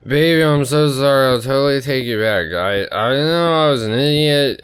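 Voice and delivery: put-on high, loud, drunken sweet-talk to a girlfriend; drunk apology. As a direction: gush an apology into a cigarette box. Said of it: "I was so gone".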